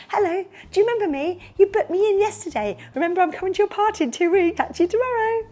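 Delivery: high, loud, fast and over-keen; pestering over-eager entertainer. Shown as a demonstration. Entertainer pestering a client by phone, hammed-up eager. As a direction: gabble a too-cheery phone reminder. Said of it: "I don't be like this".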